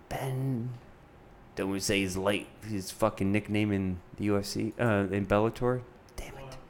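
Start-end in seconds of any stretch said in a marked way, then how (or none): none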